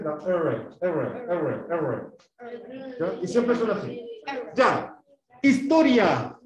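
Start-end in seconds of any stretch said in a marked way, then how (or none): 0.1-3.0 R repeated with tongue curled, no trill; English R. Shown as a demonstration. Teacher drills class on English sound, R untrilled and curled. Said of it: "así se pronuncia como en inglés".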